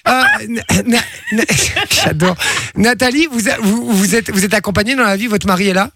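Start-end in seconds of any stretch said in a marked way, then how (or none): 0.5-2.4 laughing. Real, not acted. Host cracks up on name.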